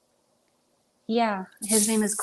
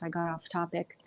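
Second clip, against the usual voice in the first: quieter confession; 7 dB quieter than usual. Real, not acted. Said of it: "that is the truth".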